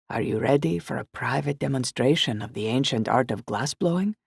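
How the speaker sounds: put-on light Italian shopkeeper accent; a friendly Italian welcome, lightly voiced. Glassblower welcoming guests at the door, light Italian accent. Raphael's theatrical pitch.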